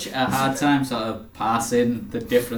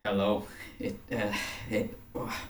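very soft, halting acted tearfulness; hushed and faltering, near tears; fighting back tears; 3 semitones lower, 12 dB quieter than usual. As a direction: stumble quietly over the words, holding back tears. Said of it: "He's sort of fighting back the tears".

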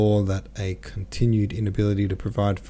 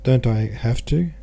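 higher, louder tearful question; a touch of a sob in a raised-pitch plea; mild sobbing; 4 semitones higher, 5 dB louder than usual. A narrator's reading. Tearful daughter pleads with her father, mildly sobbing. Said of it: "she sobbed".

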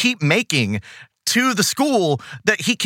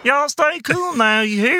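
put-on meek Southern accent; 10 semitones higher than usual. Host mimicking Dusty Hayes's line, meek Southern accent. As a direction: imitate a Southern accent with no throat behind it. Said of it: "so meek sounding".

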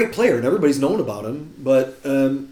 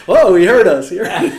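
loud, higher, laughing startled reaction; mock alarm; 6 semitones higher, 10 dB louder than usual. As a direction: play caught-out hecklers jumping back, amused. Retold startled reaction of the hecklers.

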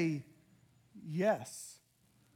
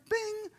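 high bing; 14 semitones higher than usual. Retold or imitated. Preacher imitating a phone's text alert with a high bing.

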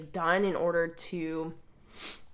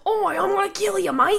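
much higher, louder Australian thug, cracking up; hammed-up Australian accent while laughing; laughing Aussie imitation; 13 semitones higher, 7 dB louder than usual. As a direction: parody the Aussie threat through laughter. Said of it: "Why is Josuke Australian".

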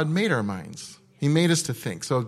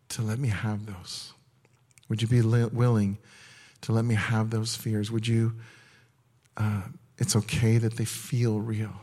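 hushed prophetic ministry voice; prophetic word in the Father's voice. Acted. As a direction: speak as a loving father reassuring a frightened child.

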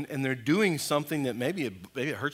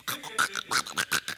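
rapid voiceless dog-racket imitation; imitated dog noise; 12 semitones lower, 3 dB quieter than usual. Husky acting up in the night, imitated dog noise. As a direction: imitate a dog going berserk. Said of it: "but just".

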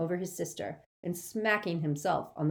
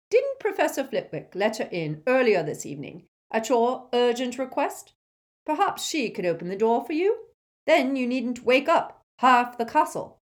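pointed reproach; 8 semitones higher, 7 dB louder than usual. A narrator's reading.

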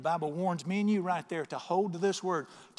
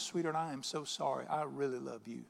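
soft, low, heartfelt apology; tender, sincere; 3 semitones lower, 6 dB quieter than usual. Acted. Husband turning to comfort his upset wife, tender. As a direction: speak gently and mean every word.

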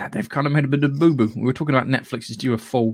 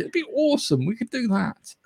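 put-on excited kid voice; 11 semitones higher than usual. Imitating producers playing with toys.

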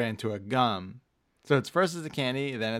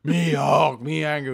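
Hulk; 3 semitones higher, 5 dB louder than usual. Retold or imitated. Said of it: "he's like the Hulk to us".

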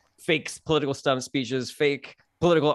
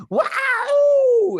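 mock crowd cheer; 7 dB louder than usual. Crowd reacting to comic's question.

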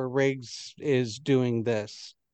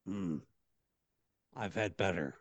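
low gruff guard; 7 semitones lower, 11 dB quieter than usual. Acted. Guard tastes the crayon.

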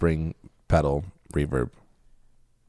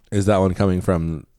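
ordinary talk, nothing marked

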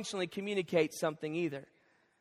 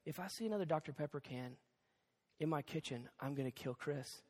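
much softer, low menacing warning; quiet threat; 8 semitones lower, 16 dB quieter than usual. Retold or imitated. Wife warning husband about his friend's cans, quiet threat. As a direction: say it softly like a deadly promise.

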